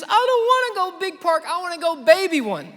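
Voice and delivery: mock tearful toddler voice; toddler through tears. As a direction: whimper it like a crying two-year-old. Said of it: "He said through his tears".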